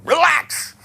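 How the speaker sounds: "Relax" snapped out fast, high and loud; "Relax" blurted high and fast; quick relax. Shown as a demonstration. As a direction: fire off "Relax" fast and high, all tension. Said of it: "if you say it too fast".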